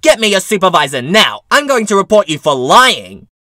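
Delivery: loud, sharp demand for the manager; retold scream. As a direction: bark it at the cashier's face. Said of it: "press her finger into my chest and scream".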